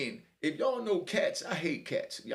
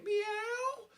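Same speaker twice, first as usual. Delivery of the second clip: high, drawn-out cat wail; meowing cat; much slower than usual. Retold or imitated. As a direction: meow long and high like a crying tomcat. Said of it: "that night I hear again".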